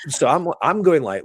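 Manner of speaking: ordinary talk, nothing marked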